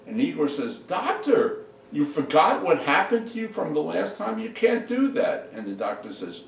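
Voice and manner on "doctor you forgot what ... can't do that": high-pitched, pleading objection; alarmed protest. Acted. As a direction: plead with the boss, pitch jumping up. Igor protests the plan.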